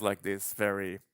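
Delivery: ordinary delivery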